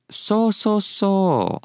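high, drawn-out so's; lightly voiced authority figure saying I see; authoritative acknowledgment. Shown as a demonstration. Teacher demonstrating an authority's reply, knowing and authoritative. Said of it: "as an authoritative figure".

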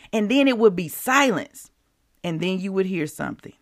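usual speaking voice

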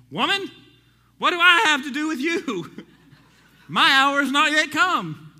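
high, loud, pleading Jesus voice; loud, high rebuke with a laugh at the end. Acted. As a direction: cry it out as Jesus, almost laughing. Preacher acting Jesus' reply.

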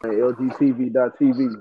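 normal talking voice

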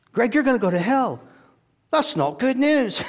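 high, loud imitation preacher; Derek Prince. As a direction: declare it in the borrowed English preacher voice. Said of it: "I hear it from him".

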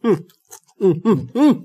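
humming while chewing; crunching and humming between bites. Real, not acted. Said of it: "Nih, suarane".

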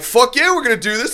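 very high, hyped reply; fired-up agreement. Retold or imitated. Drunk heckler calling the singer's bluff, high hyped agreement. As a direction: pitch it way up, eagerly matching the dare. Narrator answers the dare, retold.

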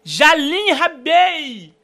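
long, loud, high announcer call; drawn-out shout. Acted. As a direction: call the name out like a show host. Host hails a caller's name.

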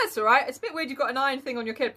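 ordinary talk, nothing marked

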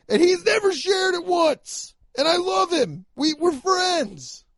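worked-up, high, strained outburst; emphatic near-scream. Real, not acted. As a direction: protest at the top of your register. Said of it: "I'm just being emphatic".

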